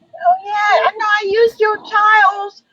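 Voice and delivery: put-on high whiny childish voice. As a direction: babyish and teary.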